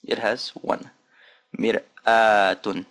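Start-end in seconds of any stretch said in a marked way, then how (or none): 1.6-2.8 Mir'atun drawn out very slowly; teacher slowing the word down; slow, stretched model. Shown as a demonstration. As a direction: stretch Mir'atun so the alif shows. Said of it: "Ah, you see that?".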